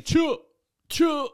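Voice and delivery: high-pitched whooped catchphrase; short high hoot; catchphrase call. Retold or imitated. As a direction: let it ring out high, like a signature call. Calling out his signature catchphrase.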